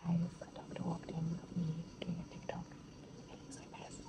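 secret whisper to camera; hushed, so the doctor won't hear. Real, not acted. Doctor in room, hushed commentary.

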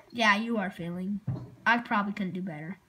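ordinary delivery

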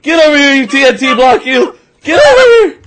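loud, high-pitched chasing yell; urgent shout. Real, not acted. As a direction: holler after someone getting away, pitch climbing. Seeker chasing last hider.

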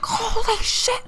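very high squealing holy shit; terrified. Retold or imitated. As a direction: shriek it in fright. Friend reacting to turtle.

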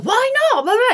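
high, indignant mock question. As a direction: toss it back defiantly. Acted defiant quote, high pitch.

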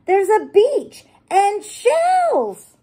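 put-on childlike wonder, slow and high; Peppa, excited. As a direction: squeak it out as Peppa in awe. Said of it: "gasped Peppa".

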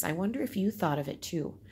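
normal talking voice

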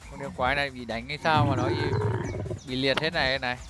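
loud excited commentary; live play-by-play. Acted. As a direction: call the monster fight loudly.

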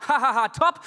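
loud, high sarcastic ha-ha; sarcastic laughing retort to the ex; mock laugh. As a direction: fake a big scornful laugh. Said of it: "Und dann meinte ich so".

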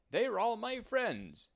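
happy driver showing off his friends; happy man. Acted. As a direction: beam it out to the whole farm. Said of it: "he said".

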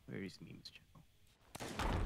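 slow unvoiced whisper. Real, not acted. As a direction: whisper it slowly to yourself. Whispered search.

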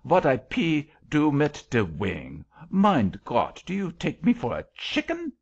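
indignant angel, pitch climbing into the question; highly incensed. A narrator's reading. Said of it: "highly incensed".

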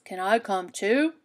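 a sly wolf asking politely. Acted. Wolf asking the girl, lightly voiced. Wolf asks, different timbre.

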